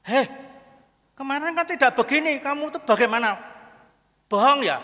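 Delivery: loud, high accusing outburst; indignant complaint. Acted. Mukidi complains to the angel, loud indignant accusation. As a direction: play an outraged customer demanding answers. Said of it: "dia komplain pada malaikat".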